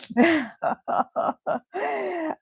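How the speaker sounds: long laugh after a joyful cry; real laughing release; happy tearful laugh. Real, not acted. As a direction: laugh it out, overwhelmed with joy. Laughing through happy tears.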